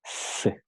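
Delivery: "C" whispered as a plain S hiss, the way Latin America says it; unvoiced S hiss for the letter "C"; seseo S sound. Shown as a demonstration. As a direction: show the Latin American S for C, no lisp. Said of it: "lo pronuncian como".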